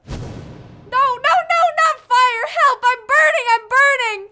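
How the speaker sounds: high, loud panic; panicked screaming. Acted. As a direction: scream for help in terror. Scarecrow set on fire.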